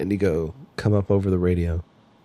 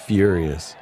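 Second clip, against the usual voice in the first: usual speaking voice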